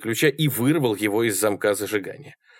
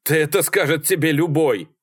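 higher, indignant woman's yell; shouted retort; 6 semitones higher than usual. A narrator's reading. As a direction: shout it back at him. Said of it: "проорала Салли в ответ". (громкий выкрик в ответ)

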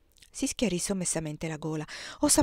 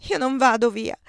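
despairing; 4 semitones higher, 5 dB louder than usual. Acted. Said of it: "gridava disperata".

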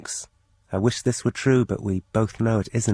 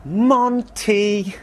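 the unseen neighbour's cat call, much higher and cheerful; imitating the stranger's call; 10 semitones higher, 4 dB louder than usual. Mysterious neighbour aping his call, high and carefree. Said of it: "somebody went".

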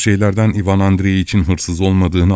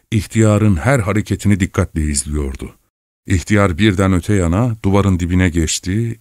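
usual speaking voice

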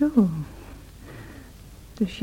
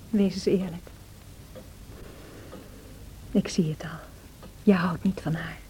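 soft, bitter whisper; a wounded whisper to Heathcliff; hushed whisper; 7 semitones lower, 10 dB quieter than usual. Acted. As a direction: whisper it, cutting and low. Quarrel, Cathy confronts Heathcliff.

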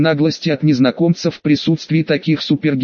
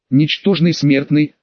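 usual speaking voice

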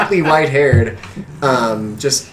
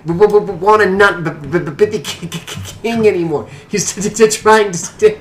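high, laboured stammer on opening consonants; sputtering stutter; 7 semitones higher, 3 dB louder than usual. Acted. Cut-lipped father explaining about the king, high sputtering stutter. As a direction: sputter through nearly every word. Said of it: "He's gonna sputter at you".

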